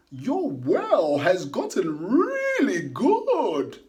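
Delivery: high, loud, slow admiring praise; Destiny's voice. A narrator's reading. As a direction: praise her like an old friend. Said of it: "Destiny said".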